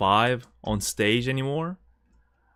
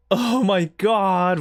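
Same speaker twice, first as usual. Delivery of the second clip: mock gasp; 8 semitones higher, 4 dB louder, much slower than usual. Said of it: "It's like, or like".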